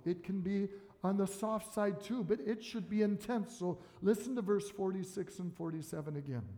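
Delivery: ordinary talk, nothing marked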